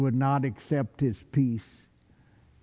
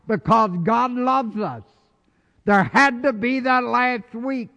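rising preaching; 9 semitones higher, 4 dB louder than usual. Acted. Preaching builds up.

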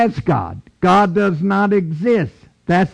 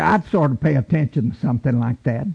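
ordinary delivery

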